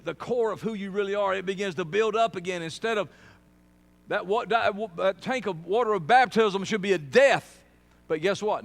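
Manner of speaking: loud, high preaching at full force. Real, not acted. Preacher driving the point home to a congregation, forceful.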